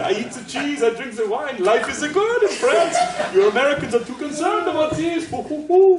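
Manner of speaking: hammed-up French accent, high and loud; a jolly Frenchman mocking uptight Americans in a French accent; happy fat Frenchman. Teacher mocking the French attitude to Americans, hammed-up French accent. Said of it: "happy, fat Frenchman".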